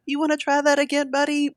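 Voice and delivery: lightly voiced parent offering a redo; mock-sweet tone to a child; teasing parent. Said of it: "me saying, like".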